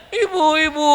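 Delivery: put-on crying child. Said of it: "nangis ya".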